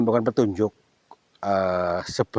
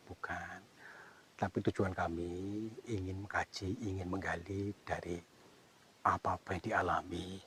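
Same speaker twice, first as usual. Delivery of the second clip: hushed explanation; 3 semitones lower, 7 dB quieter than usual. Real, not acted. Explaining quietly to spirit.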